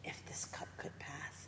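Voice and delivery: hushed, voiceless whisper; a soft whispered prayer; whispered plea. Retold or imitated. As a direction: breathe it like a secret prayer in the dark. Jesus pleading in the garden.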